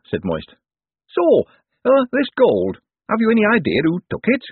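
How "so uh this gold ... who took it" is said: high, loud, hesitant question; anxious, squeaky probing; nervous fishing. A narrator's reading. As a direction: ask too casually, voice pitched right up. Hubert nervously asks about missing gold.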